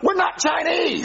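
high slow insistent yell; exasperated shout. Acted. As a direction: shout the denial in frustration. Denying being Chinese spies.

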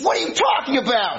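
high, baffled outburst; baffled protest. Acted. Accused man answering interrogator, baffled protest.

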